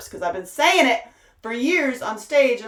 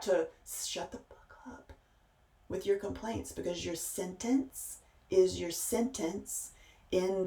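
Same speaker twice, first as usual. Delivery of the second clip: much softer, slow and grave; hushed warning; 8 dB quieter, slower than usual. Real, not acted. Preacher warning followers, soft and slow. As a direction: drop to a near-hush to make it land.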